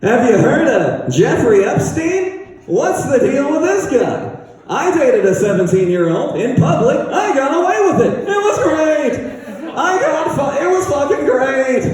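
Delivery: high, sing-song Seinfeld bit, with laughs in it; the nasal observational-comic cadence, pitched high and bouncy; hammed-up Seinfeld impression, rising to a near shout. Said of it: "do the whole set in a Seinfeld voice".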